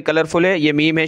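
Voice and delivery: normal talking voice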